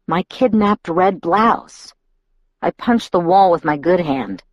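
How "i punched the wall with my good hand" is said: plain reading voice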